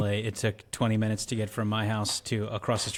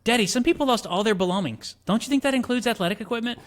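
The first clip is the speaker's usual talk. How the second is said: high, louder pleading daughter voice; quoting Cher; 11 semitones higher, 5 dB louder than usual. Acted. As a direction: argue with Daddy as an earnest teen. Acting out Cher arguing with Daddy.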